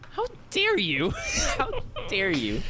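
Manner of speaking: very high, hammed-up outrage; mock indignation. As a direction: act scandalized in a squeaky-high pitch, laughing between. Acted outrage at teacher's request.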